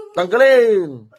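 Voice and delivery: long, loud, high order with a falling end; shouted command. Real, not acted. Healer ordering spirit to remove it, drawn-out shouted command. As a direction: bellow it and draw out the last syllable.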